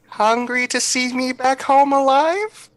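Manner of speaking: put-on high child voice, tentative question; innocent little kid. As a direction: plead like a small kid in a high voice. Child character answers the man.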